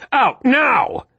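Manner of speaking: high, hard command shout; yelled. A narrator's reading. Marcus ordering Sam out of the cab, yelling. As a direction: force her out this second. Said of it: "Marcus yelled".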